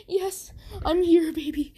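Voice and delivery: high, tearful and choked up; tearful mother. A narrator's reading. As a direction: say it through happy tears. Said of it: "Inko starts bawling her eyes out".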